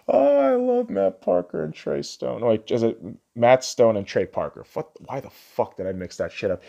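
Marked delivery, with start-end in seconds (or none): none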